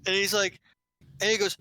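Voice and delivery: usual speaking voice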